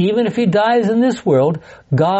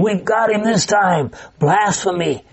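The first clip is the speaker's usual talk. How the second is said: higher-pitched mock triumph of smug accusers; audible gloating smile; 3 semitones higher than usual. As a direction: pounce gleefully, as if catching him out. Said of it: "almost hear them smiling".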